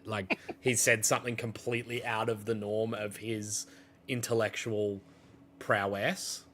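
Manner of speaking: ordinary talk, nothing marked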